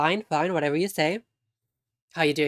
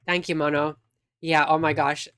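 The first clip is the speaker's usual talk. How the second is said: normal talking voice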